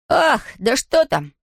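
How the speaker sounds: high, slow exasperated cry; exasperated shout. Acted. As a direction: throw up your hands and cry out. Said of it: "крикнул Том".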